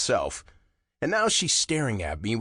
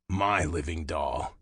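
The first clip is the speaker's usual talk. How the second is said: slow possessive murmur; 6 semitones lower, slower than usual. A narrator's reading. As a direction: murmur it like a secret claim. Possessive whisper to himself.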